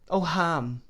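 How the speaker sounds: put-on tearful young woman, high and pleading; weeping pitifully. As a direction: call his name through tears. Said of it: "still weeping pitifully".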